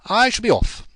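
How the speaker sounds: brisk goodbye; lightly voiced young man excusing himself; Will taking leave. A narrator's reading. Will leaving quickly.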